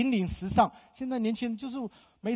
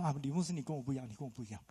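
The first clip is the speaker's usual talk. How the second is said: fast, dismissive brush-off, fading softer; mimicking listener; 7 semitones lower, 9 dB quieter, much faster than usual. Mimicking a church member dismissing the pastor, quick and soft. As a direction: rattle off the excuse, trailing away. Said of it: "你说".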